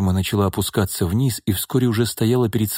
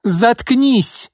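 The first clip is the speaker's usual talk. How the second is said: much higher furious yell; losing it; 4 dB louder than usual. Acted. Izolda loses her temper and yells at Irma. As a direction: snap completely and scream. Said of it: "окончательно вышла из себя Изольда".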